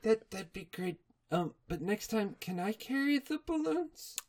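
soft, high timid pony voice; timid Fluttershy. Retold or imitated. Fluttershy to Pinkie Pie, soft and timid. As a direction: ask meekly and softly. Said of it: "she's just dragging herself".

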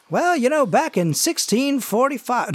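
high-pitched farmhand telling local history; Jimbo. Acted. Farmhand describing the land to hayride riders, high rustic voice. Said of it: "whose name is Jimbo. He's a farmhand".

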